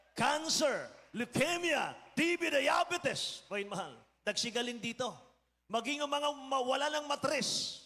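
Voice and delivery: high, ringing list of healings; excited testimony. Real, not acted. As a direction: proclaim each disease triumphantly. Preacher listing healings. (pastor na masiglang naglilista ng gumaling)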